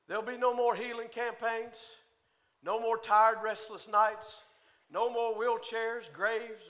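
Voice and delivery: normal talking voice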